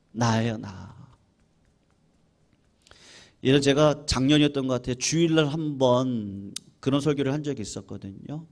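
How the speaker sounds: normal talking voice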